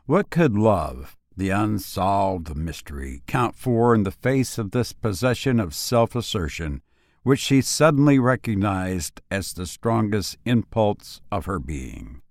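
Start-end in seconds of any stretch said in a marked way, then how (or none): none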